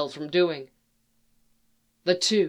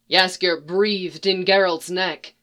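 plain reading voice

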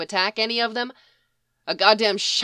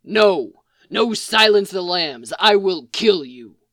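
gruff threat; 6 dB louder than usual. A narrator's reading. Mick threatening over movies.

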